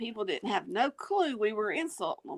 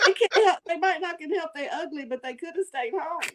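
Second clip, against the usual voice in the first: quoting mom; 6 semitones higher than usual. Retold or imitated. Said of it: "what she say, Mama Dog?".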